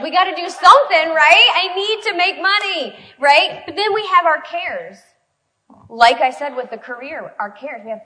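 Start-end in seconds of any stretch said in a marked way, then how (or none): 0.0-5.0 high, loud, fast worried rush; frantic. Retold or imitated. Wife pleading about money, frantic and high. Acting out desperate money plea.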